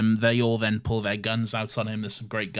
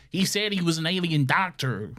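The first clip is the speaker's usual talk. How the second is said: high put-on Western lad; young boy; 6 semitones higher than usual. Podcaster playing the young boy, high accusing voice. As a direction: blurt it high like a tattling boy. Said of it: "the young boy is like".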